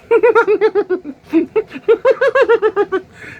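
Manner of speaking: high, loud mocking cackle; imitated crowd laughter. Guy imitating people laughing behind him, high cackle. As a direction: mimic the laughter he heard.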